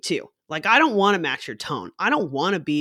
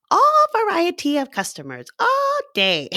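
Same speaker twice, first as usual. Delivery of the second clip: exaggerated emphasis; 12 semitones higher, 4 dB louder than usual. Real, not acted. Barista telling of endless customers, exaggerated. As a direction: stretch ALL way up high, louder at first.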